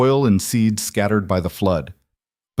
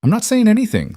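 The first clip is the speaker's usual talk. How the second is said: much higher, louder grinning denial; teasing friend; 13 semitones higher, 4 dB louder, faster than usual. A narrator's reading. Sarah teasing Eliza about Caleb, high and grinning. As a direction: play innocent while clearly teasing. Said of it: "still grinning".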